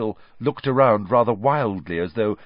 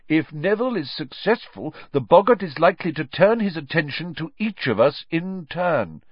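higher-pitched, animated professor voice; encouraging teacher, pitched well above narration; Professor Lupin teaching; 8 semitones higher, 4 dB louder than usual. Acted. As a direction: explain to the class with emphatic key words.

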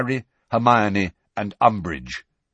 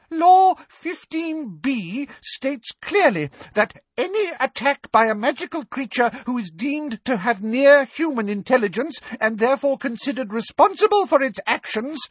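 cowering plea; 4 dB louder than usual. Acted. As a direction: recite the law shrilly in fear. Umbridge voice held.

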